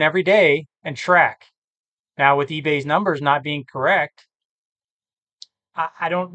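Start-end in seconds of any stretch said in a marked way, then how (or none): none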